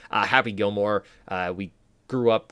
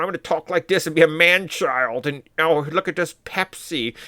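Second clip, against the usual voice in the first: put-on high, goofy man-child voice; Adam Sandler's stupid voice; 7 semitones higher, 5 dB louder than usual. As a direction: put on a silly, overgrown-kid voice, pitched up. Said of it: "he kinda just does a stupid voice".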